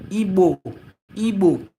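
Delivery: "Igbo" as two clean syllables ending in oh; teaching pronunciation. Shown as a demonstration. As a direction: say "Igbo" clearly, ee-boh, falling at the end. Teaching the word to viewers.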